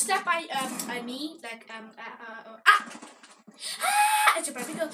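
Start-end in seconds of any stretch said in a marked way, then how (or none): 3.8-4.4 sudden high-pitched shriek; high scream. Real, not acted. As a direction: let out a startled shriek. Scream in game.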